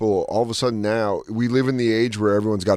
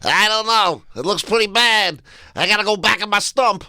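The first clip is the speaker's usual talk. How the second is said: high, comic groundhog forecaster; Punxsutawney Phil; 9 semitones higher than usual. Acted. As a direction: pitch it way up, a groundhog reporting on viruses. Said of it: "he was our Puxatawny Phil for viruses".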